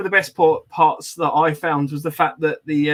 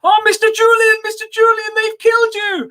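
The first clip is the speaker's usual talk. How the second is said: very high, louder squeal of panic; terrified sidekick; 5 dB louder than usual. Retold or imitated. Guide being dragged out, shrieking high at Julian. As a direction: shriek like a doomed helper. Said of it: "he was looking at me, and he was like".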